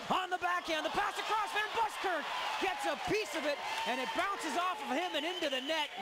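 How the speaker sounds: excited goal call. Real, not acted. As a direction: build to the goal. Goal call on a scoring play.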